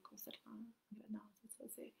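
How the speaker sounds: breathless whisper trailing off; hushed, fading list. Shown as a demonstration. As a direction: whisper the words as if exhausted. Whispered repetition of the cycle.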